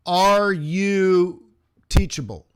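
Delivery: loud, pointed question; loud challenge. Real, not acted. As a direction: demand the answer, hit "Are you" hard. Challenges students, then repeats softly.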